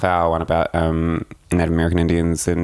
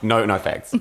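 ordinary talk

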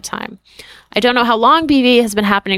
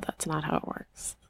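near-whisper; 3 semitones lower, 17 dB quieter than usual. Real, not acted. Stunned reply, near silent.

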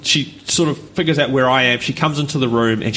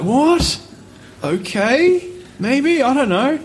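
much higher, rising, unsure; baffled and unsure; 12 semitones higher, slower than usual. Retold or imitated. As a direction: stammer out a confused yes. Baffled reaction, retold.

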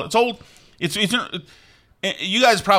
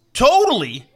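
a light Brooklyn twang on totally, raised and louder; Brooklyn accent; 8 semitones higher, 5 dB louder than usual. Retold or imitated. Mimicking Beastie Boys, a hint of Brooklyn accent.

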